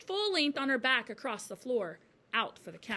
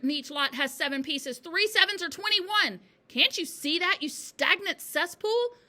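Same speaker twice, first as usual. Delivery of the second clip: loud, high tirade; furious shouting; 8 semitones higher, 7 dB louder than usual. A narrator's reading. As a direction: hurl the insults at the pupil. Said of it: "shouted the Trunchbull".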